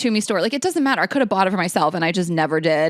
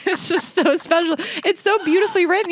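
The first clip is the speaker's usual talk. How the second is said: high, teary voice, a bit fast; choking up with gratitude; tearful gushing; 12 semitones higher than usual. Real, not acted. Thanking friend for the card, voice tearful and high.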